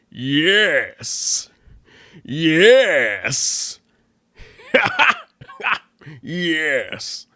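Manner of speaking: hammed-up villain yell, drawn out with a cackle; loud, stretched cartoon villain cry; Plankton. Comedian demonstrating his costume's cartoon villain to listeners, loud villain impression. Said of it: "he sounds like this".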